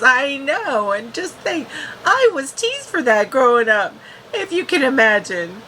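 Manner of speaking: hint of a teasing young girl; lightly voiced girl. Voicing Elora's wry remark.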